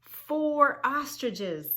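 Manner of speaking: slow, high and loud title call; dramatic announcing. Shown as a demonstration. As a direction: stretch out the page title for the kids. Dramatic reading of book page.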